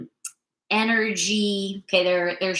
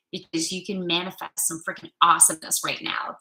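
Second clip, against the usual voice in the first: normal talking voice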